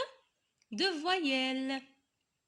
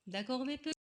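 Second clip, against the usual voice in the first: ordinary delivery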